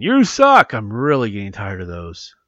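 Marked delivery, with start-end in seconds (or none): 0.0-0.7 much higher and louder, a hammed-up jeering "You suck"; mock hate mail. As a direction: play a heckler sneering at the host, over the top. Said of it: "please no email saying".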